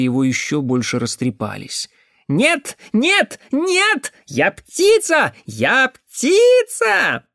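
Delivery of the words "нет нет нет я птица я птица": very high cawing cries, words drawn out; frantic bird-boy. Acted. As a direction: insist in a high crow-like cry. Bird-like simpleton insists, shrieking. (высокий птичий крик дурачка)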